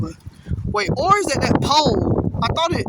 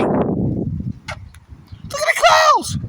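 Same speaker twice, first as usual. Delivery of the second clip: excited shout; 14 semitones higher than usual. Real, not acted. Excited at clouds.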